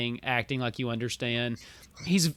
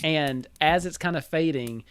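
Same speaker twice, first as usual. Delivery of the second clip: ordinary talk, nothing marked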